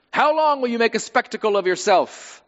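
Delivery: high, indignant acted rebuke; mock scolding. Eli rebuking a woman he thinks intoxicated, scolding. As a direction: play an outraged priest telling someone off.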